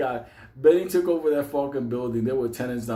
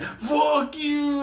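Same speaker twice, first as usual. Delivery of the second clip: retold yell. Mike yelling at a man on the train, high mock yell. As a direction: yelp it at the guy on the train.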